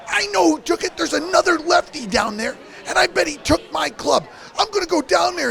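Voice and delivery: imitating a friend getting fired up about a thief; retold agitated rant. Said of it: "he goes".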